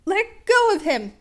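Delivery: loud high yell to free his friend. A narrator's reading. Midoriya yells at the villain to let go. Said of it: "Midoriya yelled".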